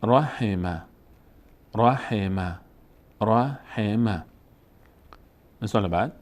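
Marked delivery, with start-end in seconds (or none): 0.0-4.2 syllables run together as one word; read joined up. Shown as a demonstration. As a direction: repeat the whole word, syllables connected. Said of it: "پشت سر هم بخوایم بخوانیم".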